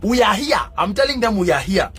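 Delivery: normal talking voice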